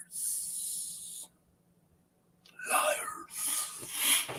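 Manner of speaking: unvoiced teasing whisper. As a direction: breathe it out as a tease. Whispering after fishing lies joke.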